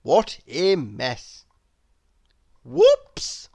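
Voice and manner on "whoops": high, loud squeal of whoops; squeaky whoops. A narrator's reading. Narrator squeals when eggs drop. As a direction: squeal it like a sudden slip. Sound-effect exclamation after accident.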